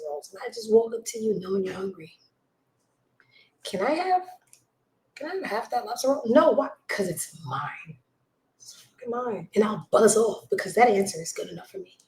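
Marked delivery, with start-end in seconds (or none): 3.6-6.2 mock begging. Begging for lobster roll. 6.9-9.5 said slowly under the breath; a hint of a slow possessive whisper. Whispering to the lunch thief that it's hers. Refusing to share lobster roll.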